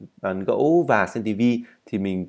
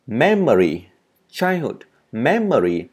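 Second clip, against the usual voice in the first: each word punched on its first syllable, then falling; separate, emphatic drill words; stress on first syllable; 3 semitones higher, 4 dB louder, slower than usual. Shown as a demonstration. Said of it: "Childhood cũng cho âm giờ vào một".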